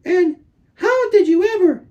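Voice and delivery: put-on very high, gushing girl's voice; high, breathless girl's voice, thrilled and fussing; delighted gushing. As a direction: gush over the lost pet in delight. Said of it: "said things like".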